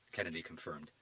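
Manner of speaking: ordinary delivery, nothing marked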